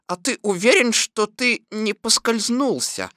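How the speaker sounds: measured, reasoning query; reasonable questioning. A narrator's reading. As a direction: ask it judiciously, slowing at the end. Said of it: "рассудительно спросил кролик".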